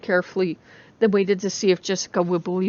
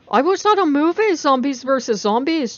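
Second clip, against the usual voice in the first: high, loud call after someone; 6 semitones higher, 5 dB louder than usual. A narrator's reading. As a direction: call out to her retreating back. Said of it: "Charlie called out half-hurriedly".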